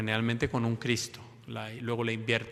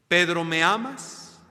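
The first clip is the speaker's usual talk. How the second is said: slow pleading question; 5 semitones higher, 5 dB louder, slower than usual. Acted. Jesus asks again.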